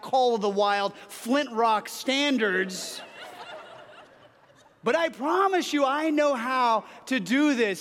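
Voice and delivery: slow, pointed, exasperated mock tirade; retold exasperated outburst. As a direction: blow up at your dad, laughing at yourself. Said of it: "I find myself saying something like this".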